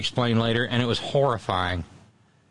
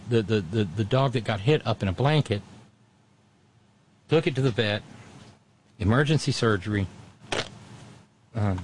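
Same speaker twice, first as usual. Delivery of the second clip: ordinary talk, nothing marked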